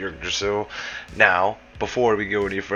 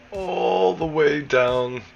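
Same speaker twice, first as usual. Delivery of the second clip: ALL THE WAY DOWN stretched out slowly on a high, sliding pitch; drawn-out emphasis; 9 semitones higher, much slower than usual. Real, not acted. Gamer showing viewers a hell of a fall, slow high drawn-out emphasis. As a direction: draw out "All the way down" high and long, like a long drop.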